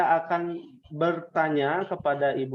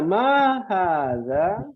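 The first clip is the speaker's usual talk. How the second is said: slow drill question; 2 semitones higher, much slower than usual. Shown as a demonstration. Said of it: "teacher drilling class".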